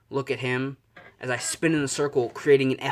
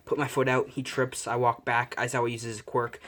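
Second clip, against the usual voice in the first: ordinary delivery, nothing marked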